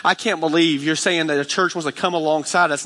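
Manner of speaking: ordinary talk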